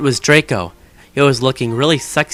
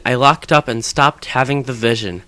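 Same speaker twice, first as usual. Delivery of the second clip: ordinary delivery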